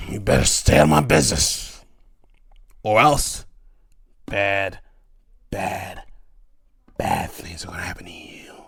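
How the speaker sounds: loud warning sinking to a whisper; threaten, then drop to a hush; menacing Vince threat. Retold or imitated. Imitating Vince warning Hebner, loud then whispered menace. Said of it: "he tells Edner".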